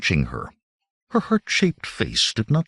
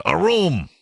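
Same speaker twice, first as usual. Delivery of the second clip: bellowing; 8 semitones higher than usual. A narrator's reading. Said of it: "Conan bellowed".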